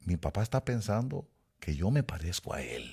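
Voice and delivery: ordinary delivery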